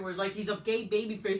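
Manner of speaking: normal talking voice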